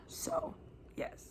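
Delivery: trailing whisper. Real, not acted. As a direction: let it fade to a whisper. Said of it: "whispered aside".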